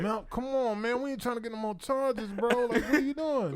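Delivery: high-pitched retold complaint; whiny mock exasperation; exasperated pleading. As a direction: beg your friend to quit it. Friends pleading with Melvin to stop.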